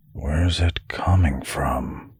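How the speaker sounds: slow, hushed mutter. A narrator's reading. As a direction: mutter it softly, half whispered. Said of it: "Ven muttered".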